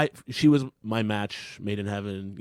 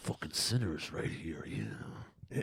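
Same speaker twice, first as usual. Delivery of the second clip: lightly gravelled wrestling promo growl; low, quiet wrestler promo voice; Macho Man Randy Savage; 4 semitones lower, 10 dB quieter than usual. Retold or imitated. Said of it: "you sound like Macho Man Randy Savage cutting a promo".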